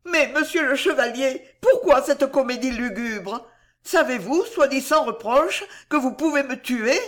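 high, indignant questioning; flustered reproach. A narrator's reading. Juana questions the chevalier's grim comedy, flustered reproach. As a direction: cover confusion with a high protest. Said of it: "pour cacher son trouble".

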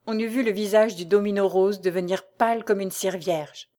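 ordinary delivery

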